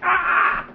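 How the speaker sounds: high yelp of pain; boy's pained cry. Acted. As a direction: cry out as if suddenly bitten. Boy bitten.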